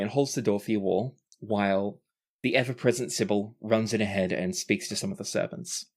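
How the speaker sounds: ordinary talk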